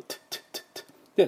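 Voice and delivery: "トゥッ" as a whispered, spitting t burst, repeated; voiceless T sound. Shown as a demonstration. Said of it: "これはまあ、Tの音".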